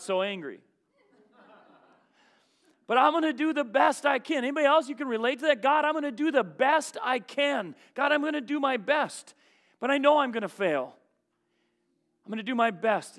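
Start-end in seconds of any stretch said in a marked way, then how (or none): 2.9-13.2 loud, higher preaching build; preacher rising to the climax; preaching at full force. Acted. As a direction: drive it home with fervour.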